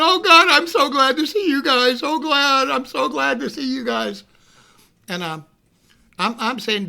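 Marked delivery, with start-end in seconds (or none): 0.0-4.2 high, loud gush of relief; overwrought relief. Retold or imitated. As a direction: gush like a hyper man just rescued. Hyper Dave greets his rescuers.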